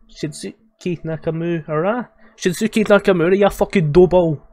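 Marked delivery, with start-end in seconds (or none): 2.4-4.4 loud, higher-pitched imitation of the heckle; belted-out mock heckle; mock-yelled insult. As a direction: yell it out, quoting a funny insult. Repeats the clip's insult, amused.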